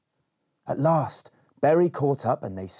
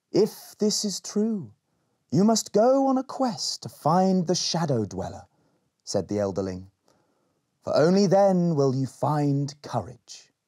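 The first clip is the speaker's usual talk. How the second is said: solemn sage; 6 semitones higher than usual. Acted. Elderling gives a quest.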